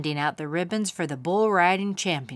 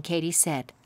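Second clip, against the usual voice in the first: ordinary delivery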